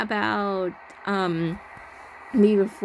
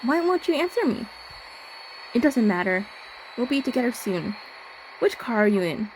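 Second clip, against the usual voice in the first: lightly voiced, higher, eerie stalker; creepy stalker voice; 4 semitones higher than usual. Player voicing the stalker closing in, slightly raised voice.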